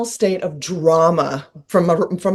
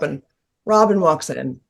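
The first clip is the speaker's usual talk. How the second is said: ordinary delivery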